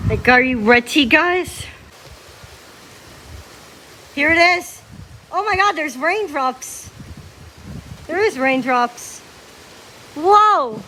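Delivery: usual speaking voice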